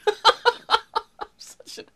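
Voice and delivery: high, laughing self-mocking line; laughing at himself. Real, not acted. Streamer cracking up after mocking food scientists, laughing. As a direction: giggle through the confession.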